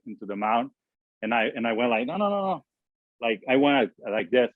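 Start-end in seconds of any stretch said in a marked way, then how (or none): 2.1-2.7 high-pitched rapid string of refusals; mock protest, voice jumping up high; retold protest. Pitcher waving his catcher off the mound, high-pitched protest.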